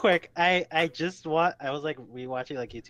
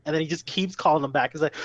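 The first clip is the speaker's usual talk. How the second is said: ordinary talk, nothing marked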